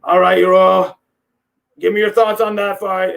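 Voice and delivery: lightly imitated fight interviewer; Joe Rogan. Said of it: "Joe Rogan's like".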